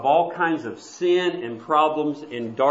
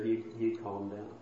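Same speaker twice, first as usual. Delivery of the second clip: usual speaking voice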